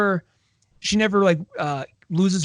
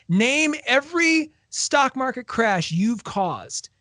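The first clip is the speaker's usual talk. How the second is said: slow, higher, deliberate demand; 7 semitones higher, much slower than usual. Retold or imitated. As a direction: lay out each word slowly like an ultimatum. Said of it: "I'll repeat again".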